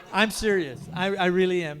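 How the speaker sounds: loud, laughing through the words. Real, not acted. Man joking to church panel about seriousness, laughing loudly. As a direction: laugh out the claim of being serious.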